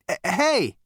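high, loud, faltering hail; calling out. A narrator's reading. Zaphod hailing the fading voice, calling out. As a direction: call after someone leaving. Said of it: "called out Zaphod".

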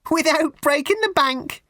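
very high, sugary raffle-lady voice; high twittering fete-lady voice on without breaking the bank; raffle woman. Acted. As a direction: simper it like a prim charity lady. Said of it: "She gave a tinkly little laugh".

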